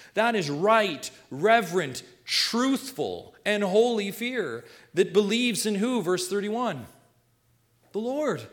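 high, loud pulpit delivery; forceful preaching. Real, not acted. Preacher warning the congregation about right and wrong fear, forceful preaching.

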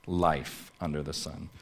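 "Life under the sun" repeated softly, dropping away. Shown as a demonstration. Preacher repeats the point to congregation, quiet and settled. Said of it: "we move into our first point".

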